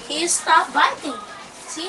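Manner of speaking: ordinary delivery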